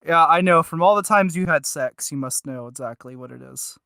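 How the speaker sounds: ordinary talk, nothing marked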